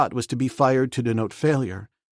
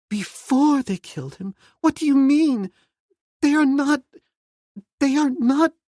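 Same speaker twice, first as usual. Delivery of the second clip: much higher and louder, in growing horror; horrified; 5 dB louder than usual. Acted. Jane to Clayton, dreading the word cannibals, horrified high voice. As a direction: cry out in disbelief, high and loud.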